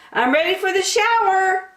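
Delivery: put-on high, childlike and ready; proud kid in rain gear; eager young turtle. Franklin announcing to Mom, eager childlike voice.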